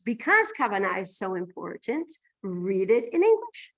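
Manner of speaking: ordinary talk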